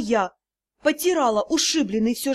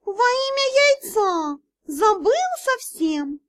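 high, slow cat exclaiming; snorting cat; 8 semitones higher, slower than usual. Acted. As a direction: blurt it out like a forgetful cat. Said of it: "фыркнул и выдал".

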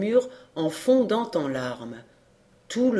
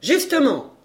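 high, loud market-woman interjection; exclamation; 7 semitones higher, 5 dB louder than usual. Acted. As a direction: cry it out, swooping down. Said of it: "s'écria la grande Hocquart". (exclamation forte et haute)

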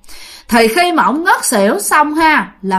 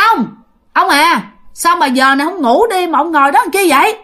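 raised, higher voice calling to husband; retold wife calling out a puzzled question; gọi hỏi; 5 semitones higher, 3 dB louder than usual. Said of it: "bả lại cất tiếng". (cất cao giọng gọi hỏi chồng)

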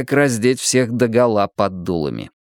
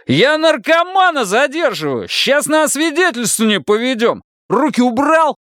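furious yell; 13 semitones higher than usual. Acted. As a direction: roar at him to let go. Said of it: "заорал лейтенант".

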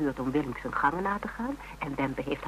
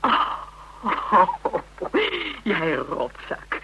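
laugh, then a slow teasing "rotzak"; laughing fondly; 5 semitones higher, 3 dB louder, much slower than usual. Acted. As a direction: tease him through the laughter. Relieved laugh at phone caller.

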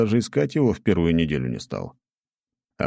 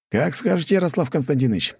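quick agreement; 10 semitones higher than usual. A narrator's reading. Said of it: "быстро кивнул Полос".

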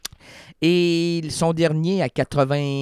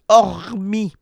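louder theatrical hormis, or not hormis; mock Shakespeare; 8 semitones higher, 6 dB louder, slower than usual. Host joking a Shakespeare pun, theatrical. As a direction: declaim hormis like Hamlet. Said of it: "Non, c'est to be or not to be".

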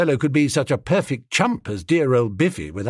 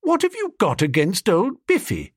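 higher-pitched direct challenge; squarely confronting; 7 semitones higher than usual. A narrator's reading. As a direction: tackle the listener head-on. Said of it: "tackling the blighter squarely".

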